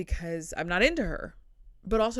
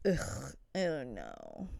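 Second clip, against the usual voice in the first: slumped couch groan; 6 semitones lower, 5 dB quieter, much slower than usual. Retold or imitated. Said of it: "I just get like".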